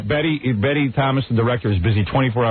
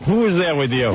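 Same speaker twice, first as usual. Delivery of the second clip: lightly imitated suspicious wife, raised pitch; mock suspicious wife; 6 semitones higher than usual. Husband mimics wife greeting him at the door, mock suspicion. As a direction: mimic a wife's interrogation, pitched up. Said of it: "when I walk in, it's always".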